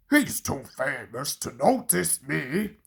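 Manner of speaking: low, shy little engine; Duck voice. A narrator's reading. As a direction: play it as timid Duck.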